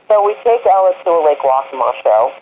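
normal talking voice

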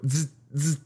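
imitated electric buzz; buzzing sound of charge; buzzing electric zap. Shown as a demonstration. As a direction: zzz like a live wire. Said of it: "an emotional charge".

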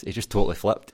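ordinary talk

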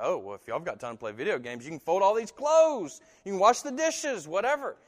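a hammed-up nagging mom or dad; annoyed parent. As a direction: play a fed-up parent listing chores.

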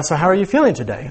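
quick, half-hearted British lilt; British inflection. Retold or imitated. Husband imitating his odd questions to his wife, British inflection. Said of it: "some weird inflection on the end".

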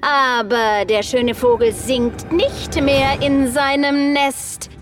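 taunting witch. Acted.

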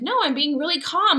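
high-pitched, acted insistence; defensive protest. Mom answers her son's accusation of meanness, defensive protest. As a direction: protest your innocence, pitched well up.

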